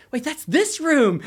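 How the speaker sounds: high, loud and drawn out in disbelief; stunned realization. Retold or imitated. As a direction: voice the kids suddenly getting it. Kids realize teacher is Jamal.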